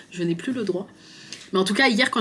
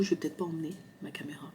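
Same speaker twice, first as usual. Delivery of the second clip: whisper; 2 semitones lower, 10 dB quieter, faster than usual. Real, not acted. Aside to self, unvoiced.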